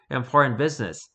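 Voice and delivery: ordinary delivery, nothing marked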